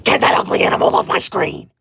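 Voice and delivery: low, rough unvoiced growl; mock growl. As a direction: snarl it at the cartoon corgi. Sudden growl at corgi animation.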